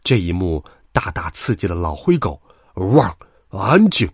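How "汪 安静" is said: slow, loud bark for silence; exasperated shout. A narrator's reading. Old grey dog breaks up a quarrel, exasperated shout. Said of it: "他终于忍不住喊道".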